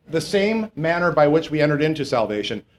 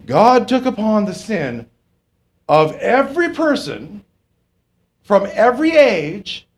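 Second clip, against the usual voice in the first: higher, slow, weighty pulpit delivery; slow emphatic preaching; 6 semitones higher, 3 dB louder, slower than usual. Acted. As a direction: lay each phrase down heavily and slowly. Preaching on sin borne.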